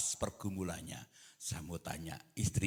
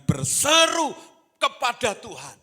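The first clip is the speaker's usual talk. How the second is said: loud, raised pulpit exhortation; full-force preaching; 10 semitones higher, 12 dB louder than usual. Real, not acted. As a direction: urge them loudly in a high voice. (seruan khotbah yang lantang)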